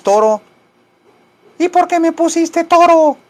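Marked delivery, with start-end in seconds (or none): 1.6-3.2 very high, indignant cat falsetto; talking cat. Pretend. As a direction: argue back as a squeaky little cat. Cat argues back in falsetto. (gato indignado en falsete agudo)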